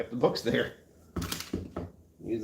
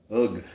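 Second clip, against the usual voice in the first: strained groan of getting up; effortful groan; 5 dB louder than usual. Real, not acted. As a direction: grunt as you push up. Standing up, sore.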